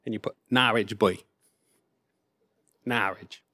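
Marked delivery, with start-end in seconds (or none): 0.5-1.2 Norwich local accent. Shown as a demonstration. Preacher demonstrating his hometown speech, Norwich boy in local accent. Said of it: "If you're a local, say Norwich". 2.8-3.4 Norwich as "NAR-idge", much lower and softer; Norwich local accent. Shown as a demonstration. Preacher trying his lost hometown accent, Norwich as local "NAR-idge". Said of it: "I've lost the accent, unfortunately".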